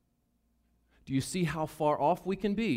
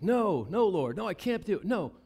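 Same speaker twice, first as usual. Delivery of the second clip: loud, much higher pleading no; Peter refusing; 6 semitones higher, 5 dB louder than usual. Acted. Peter refusing the Lord three times, loud desperate protest.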